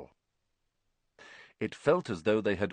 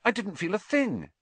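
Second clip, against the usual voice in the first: high, eager delivery; excited; 9 semitones higher than usual. A narrator's reading. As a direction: insist on it excitedly. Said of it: "Harry said excitedly".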